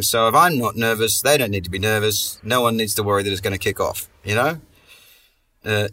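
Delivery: normal talking voice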